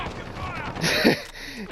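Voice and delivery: high, light giggle; real laugh. Real, not acted. Storyteller amused by his slow friend, real high laugh.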